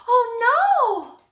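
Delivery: high, loud cry of alarm; dismayed cry. Acted. Hansel finding the door locked, alarmed. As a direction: gasp it out in sudden dismay.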